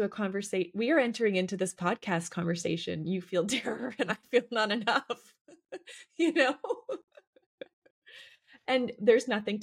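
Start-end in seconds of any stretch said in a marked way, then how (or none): 3.1-6.5 higher pitch, breaking into laughter; real amused laugh in the words. Real, not acted. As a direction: laugh through the admission. Laughs at their shared admissions.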